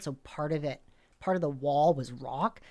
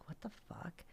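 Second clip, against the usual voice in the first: hushed, voiceless whisper; barely audible retold whisper; whispered disbelief; 4 semitones higher, 18 dB quieter than usual. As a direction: whisper it as if just woken by a noise. Wakes to knocking at night.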